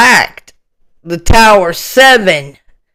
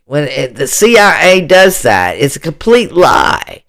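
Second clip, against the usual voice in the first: ordinary talk, nothing marked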